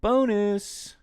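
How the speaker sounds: playful excited exclaim; delighted. Real, not acted. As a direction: savor the happy surprise. Pleased by the unexpected vibrato.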